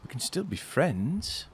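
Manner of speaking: soft, slow lilting offer; smirking overture. Acted. Smirking stranger offers friendship to his pursuers, softly coaxing. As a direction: coax gently with a slight smirk. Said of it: "he smirks a little and he goes".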